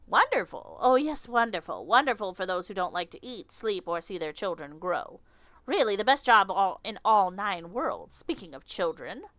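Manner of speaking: drip bitter irony over wonderful; sarcastic insect lady. Acted. Insect lady answering Victor's flattery, sarcastic.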